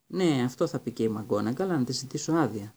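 plain reading voice

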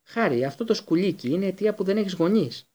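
moderately forceful outrage; angry shriek. A narrator's reading. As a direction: spit out the accusation. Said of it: "γρίλησε ο Μπλακ".

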